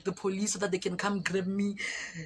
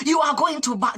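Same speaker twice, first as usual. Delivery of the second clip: high, loud incredulous scolding; mock-furious soldier's outburst; imitated outrage; 7 semitones higher, 9 dB louder than usual. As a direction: berate them in sheer disbelief.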